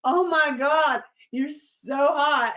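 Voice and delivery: high, slow, loud swoon. Retold or imitated. Retold swooning at the hot cop, drawn out. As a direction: gush over him, slowly. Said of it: "And I'm like".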